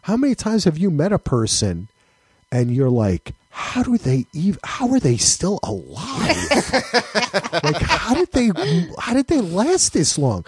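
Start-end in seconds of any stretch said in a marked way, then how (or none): 3.5-10.4 high-pitched, laughing disbelief; amused, astonished wondering, voice pitched right up; incredulous. Acted. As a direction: marvel in exaggerated bafflement. Acted disbelief at foolish people.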